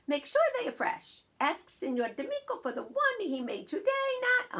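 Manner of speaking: put-on high Italian-accented Nana giving orders; insistent Italian Nana voice. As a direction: fuss over errands as an Italian grandmother. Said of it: "will have an Italian accent".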